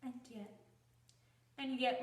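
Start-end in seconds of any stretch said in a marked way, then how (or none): 0.0-0.6 very soft, low falling "And yet"; hushed. Acted. Bride pauses over what she has known, hushed. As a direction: let "And yet" sink away quietly. Hesitant first take, then resumed.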